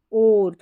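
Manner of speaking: ओर with the "o" held long; lengthened "oh" before the r in ओर; drawn-out vowel. Shown as a demonstration. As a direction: stretch ओर out a little, like "oar". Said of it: "थोड़ा लंबा उच्चारण है".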